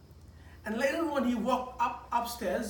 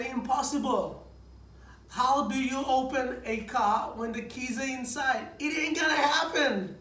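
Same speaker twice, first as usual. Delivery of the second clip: louder, higher scoffing refusal; mock exasperation; 4 semitones higher, 4 dB louder than usual. Dad scoffing at wife's plan to open car, exasperated. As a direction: wave it off as impossible.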